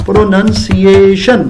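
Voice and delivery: "پروننسیشن" said very slowly, syllable by syllable; careful pronunciation. Shown as a demonstration. Teacher shows the right word after a pronunciation slip, slow. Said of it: "پروننسیشن کی غلطی ہے".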